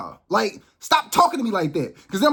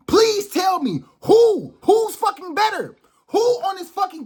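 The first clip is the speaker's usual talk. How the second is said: angry demand; 9 semitones higher, slower than usual. Real, not acted. Heated rant, demanding an answer.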